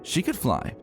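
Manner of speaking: ordinary talk, nothing marked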